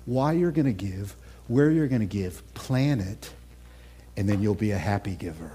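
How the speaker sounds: calm divine counsel. Retold or imitated. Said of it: "God says".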